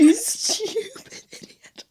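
high, drawn-out insult squeezed through laughter; slow, softened squeal of mock outrage; laughing mock insult. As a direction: stretch it out, helpless with giggles. Teller repeats insult while laughing.

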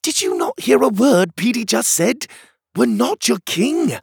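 high, insistent character voice; exasperated reminder. A narrator's reading. Pockets reminding the crowd, exasperated. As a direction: push it out high, as a rebuke.

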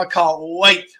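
ordinary delivery

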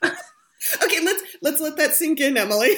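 high, loud laughing voice. Real, not acted. Host cracks up at guest's admission.

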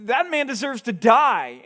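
slow, emphatic verdict of an angry king; enraged king. Retold or imitated. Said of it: "and gets enraged".

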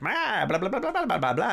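high, mocking Cockney blah-blah; mock Cockney babble. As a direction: parody a silly Cockney sidekick, pitched high. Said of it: "that accent was just ridiculous".

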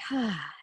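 long soft exhaled sigh after a held breath; relaxing sigh. Shown as a demonstration. Actor demonstrating relaxation breathing for callers, soft sigh. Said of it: "an exhale like a sigh".

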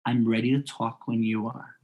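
quiet, settled, unhurried reply; calm. Shown as a demonstration. Adult answering a yelling camper, calm and quiet. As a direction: keep it low-key and steady. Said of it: "you're talking calmly".

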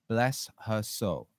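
"Bless her soul" stretched out word by word; slow, deliberate repeat. Real, not acted. Blessing repeated slowly for effect.

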